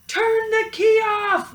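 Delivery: very high, loud urgent cry; cow crying out. A narrator's reading. Mabel the cow yelling at Molly, very high and loud. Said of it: "Mabel cried".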